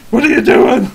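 put-on high, loud old villager's challenge; old man, alarmed. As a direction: shout it at intruders like an old farmer. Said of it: "like, the old man with, like, a pitchfork".

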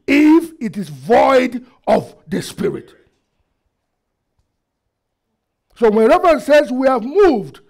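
high, loud exhortation to the congregation; forceful preaching. Real, not acted. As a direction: preach it hard, leaning long on the commands.

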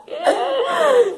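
high squealing laugh through the burn; shrieking laugh. Real, not acted. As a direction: laugh in pain and panic. Pepper burning, shrieking laugh. (risada aguda e desesperada)

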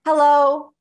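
high, loud hello; top of the breath. Shown as a demonstration. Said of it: "say hello at the top of your breath".

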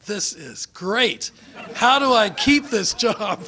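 slow, loud and laughing; delighted, laughing through the words. Real, not acted. Laughing, retelling his delighted thought.

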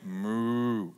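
a low, drawn-out imitation cow moo; mock cow moo. Pastor mimicking the congregation's punchline reply, mock low moo. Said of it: "And everybody went".

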